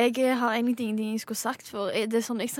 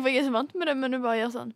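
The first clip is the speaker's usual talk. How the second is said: ordinary talk, nothing marked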